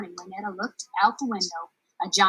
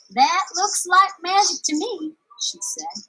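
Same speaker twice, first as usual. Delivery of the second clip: higher, bold and hopeful; girl deciding to climb, eager; determined girl; 9 semitones higher, 5 dB louder than usual. Acted. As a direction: set out on an adventure. Juanetta determined.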